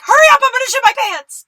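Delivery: very high, very loud panicked shout; desperate yell. Acted. Customer begging the Target cashier to hurry, desperate shout. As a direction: scream it like it's an emergency.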